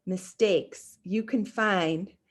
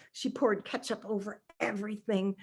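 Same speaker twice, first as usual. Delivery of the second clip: usual speaking voice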